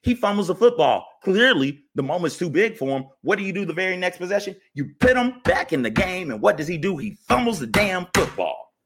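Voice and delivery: high-pitched exasperated rant; upset rant. Real, not acted. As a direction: vent hard about every bad call. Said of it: "Can you tell I'm upset about this?".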